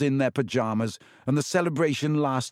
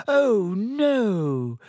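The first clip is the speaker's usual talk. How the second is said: high, louder groan of dismay; mock horror at lost exams; dismayed Hermione; 8 semitones higher, 3 dB louder than usual. A narrator's reading.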